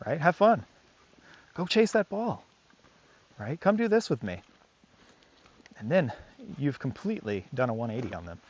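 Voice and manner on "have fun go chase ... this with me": playful coaxing. Retold or imitated.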